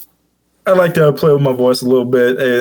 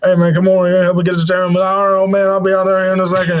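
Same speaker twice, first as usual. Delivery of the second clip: mumbled, higher down-south family talk; Boomhauer; 3 semitones higher, faster than usual. Shown as a demonstration. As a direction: run the words together in a Southern mumble. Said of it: "I sound like Boomhauer from King of the Hill".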